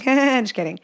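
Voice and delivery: laughing it off. Real, not acted. To the listeners, laughing it off. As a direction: repeat it through a laugh.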